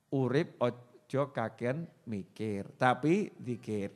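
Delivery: slow and soft, each word laid down; slow soft motto. Shown as a demonstration. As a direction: Urip ojo kakean mikir, tapi zikir said slowly and hushed.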